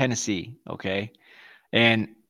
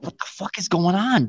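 retold bewilderment; 6 semitones higher than usual. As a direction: act out hushed-up disbelief at what's happening. Retelling bewilderment at rude waitress.

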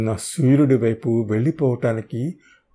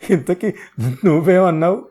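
laughing mockery; 5 semitones higher, 3 dB louder than usual. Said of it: "నేను బిగ్గరగా నవ్వి".

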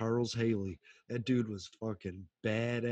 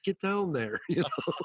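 louder, higher jeering call; mock taunt; 6 semitones higher, 3 dB louder than usual. Jeering at Tony Romo in the booth, laughing.

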